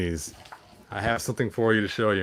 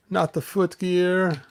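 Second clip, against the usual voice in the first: mock dismay; 7 semitones higher, slower than usual. Real, not acted. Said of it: "I knocked off his foot gear".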